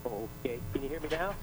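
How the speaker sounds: faint, far-off caller voice; speaking away from the phone; phone held away. Real, not acted. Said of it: "Eh, barely".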